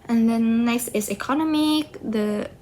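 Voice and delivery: usual speaking voice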